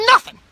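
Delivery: "nothing" punched very high and loud; emphatic NOTHING. Real, not acted. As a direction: hit "nothing" with an exasperated yell. Repeats word for emphasis.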